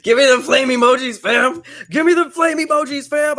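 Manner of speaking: high, loud hype shout; hype call. Real, not acted. Host hyping his chat for flame emojis, loud and high.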